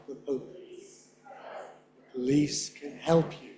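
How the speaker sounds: "can" shrunk to a tiny schwa, slow; slow reduced can. Shown as a demonstration. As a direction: let "can" nearly vanish. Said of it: "can is microscopic most of the time in native speech".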